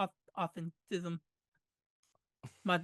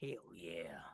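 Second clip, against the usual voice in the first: whispering; 12 semitones lower, 13 dB quieter than usual. Real, not acted. Joke about sexy skin.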